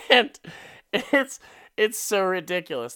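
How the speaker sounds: normal talking voice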